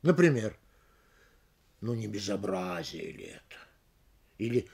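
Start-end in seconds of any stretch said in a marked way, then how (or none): 1.8-3.6 low, quiet, sinking from БЕЗОБРАЗИЕ to the end; question with falling tune. Shown as a demonstration. Lecturer demonstrating a rhetorical question, low falling tune. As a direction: ask it as an assertion, voice down on БЕЗОБРАЗИЕ. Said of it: "я произнёс их с понижением".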